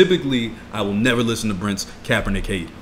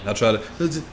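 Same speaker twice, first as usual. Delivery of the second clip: usual speaking voice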